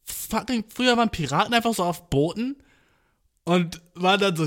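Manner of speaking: ordinary talk, nothing marked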